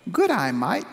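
a quick playful Aussie greeting; Australian accent. Retold or imitated. Locals greeting the speaker everywhere, in a mock Australian accent. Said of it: "somebody saying".

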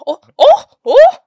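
high, loud rich-lady "oh"s; rich lady impression. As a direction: hoot like a snobby movie rich woman. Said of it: "That's my impression".

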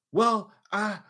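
trying to save face. Retold or imitated. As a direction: imitate sister fumbling for an excuse. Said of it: "she's trying to, like, save face".

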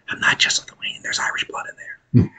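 secretive whisper of a confession; hushed confession whisper; whispered aside. Real, not acted. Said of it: "aside whisper".

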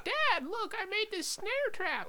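put-on very high squeaky kid showing off to dad; tiny, high put-on voice; helium-voiced son. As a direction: play a little inventor on helium. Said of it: "sound like you've been inhaling helium".

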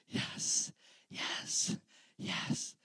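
breathless hushed yeses; whispered yes. Retold or imitated. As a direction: whisper it in stunned delight. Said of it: "they're like".

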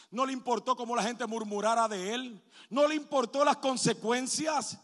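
predicando a todo pulmón, más alto y más fuerte; predicación fervorosa. Acted. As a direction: clama al púlpito con fuerza creciente. Pastor preaching Jairo's story. (predicación a plena voz)

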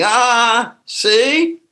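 a smug inner voice catching you out; inner voice gloating. Retold or imitated. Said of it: "I heard that voice saying".

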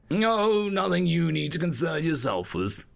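airy dismissal. A narrator's reading. Observer dismissing his assistant's question, airy. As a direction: wave the question away. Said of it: "I say airily".

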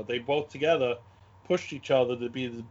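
ordinary delivery

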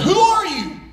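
high-pitched call into the distance. Retold or imitated. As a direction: call out high, as if to a stranger far off. Boy calls out to unknown voice.

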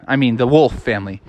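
usual speaking voice

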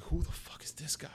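a hushed, breathy whisper; stunned whisper under the breath; whispered aside. Retold or imitated. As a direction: whisper it like a secret to a friend. Retold thought at strange outfit.

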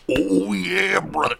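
hammed-up pro wrestler, drawing out the words; Macho Man Randy Savage. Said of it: "Macho Man Randy Savage".

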